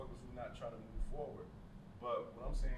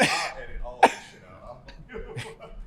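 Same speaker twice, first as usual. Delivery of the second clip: laughing; 5 semitones higher, 14 dB louder, much slower than usual. Real, not acted. Laughing at own stumble.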